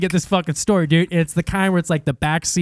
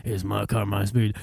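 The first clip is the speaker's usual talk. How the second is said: put-on low, soft, unbothered old driver; low elderly voice, unmoved by panic; old man; 11 semitones lower, 7 dB quieter than usual. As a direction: play a stubborn old man, quietly. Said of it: "ninety fucking year old man".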